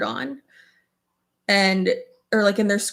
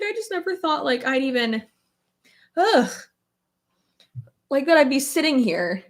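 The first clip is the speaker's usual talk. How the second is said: retelling her own teary words, pitched up; teary, high and choked; 5 semitones higher than usual. Retold or imitated. To her husband, happy tears in a high choked voice. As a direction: let the voice crack with happy tears. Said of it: "I was crying".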